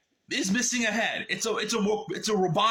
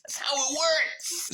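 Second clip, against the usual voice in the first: higher, slow put-on voice, mocking; sarcastic mock voice; 9 semitones higher, slower than usual. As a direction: parrot the book's logic with scorn. Sarcastic echo of the book.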